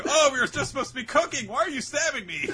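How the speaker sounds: high, loud, laughing outcry; mock panicked protest. Stabbed contestant protests to attackers, hammed-up panic. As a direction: play a contestant in comic alarm.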